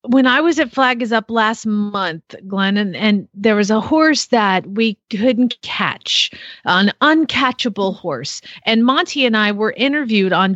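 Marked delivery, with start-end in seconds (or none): none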